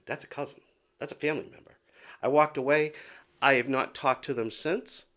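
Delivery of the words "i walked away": louder and higher, leaning on walked; emphatic repeat. Real, not acted. Telling viewers she walked away, firm and loud.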